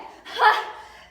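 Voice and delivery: short loud laugh; quick breathy burst of laughter. Real, not acted. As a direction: burst out in one high laugh. Laughs after her chant.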